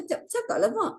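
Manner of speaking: high coaxing plea to mother; urging mother. Retold or imitated. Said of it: "saya kata". (merayu emak dengan suara tinggi)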